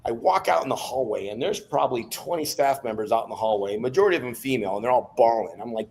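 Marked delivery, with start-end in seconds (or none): none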